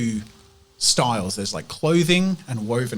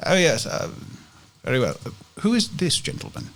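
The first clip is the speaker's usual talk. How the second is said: hesitant, polite shopkeeper; 9 semitones higher than usual. Acted. Game master plays the flustered man.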